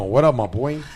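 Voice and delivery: usual speaking voice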